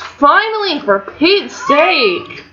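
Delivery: high, drawn-out cry of relief; excited relief. Real, not acted. Gamer seeing her sim finally go into labor, high relieved cry. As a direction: finally, stretch it out slow.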